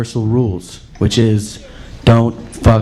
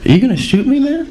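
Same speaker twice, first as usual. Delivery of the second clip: high mock deer voice; 12 semitones higher than usual. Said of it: "he's like".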